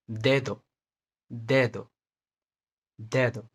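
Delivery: दे दो with a soft dental d, tongue touching the teeth, not a hard English d. Shown as a demonstration. Teacher demonstrating the soft Spanish d to learners. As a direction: say दे दो with a gentle d. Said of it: "इसको हम soft pronounce करेंगे".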